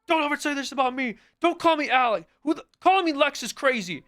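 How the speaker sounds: high, loud, put-on raging voice; hammed-up angry yelling at chat; mock screaming rant. Streamer mock-yelling at his chat in a high, loud rage. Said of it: "I fucking scream at you guys".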